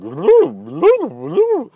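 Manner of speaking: silly high whoop; weird noise. Shown as a demonstration. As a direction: make a goofy noise for fun. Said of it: "weird noises like".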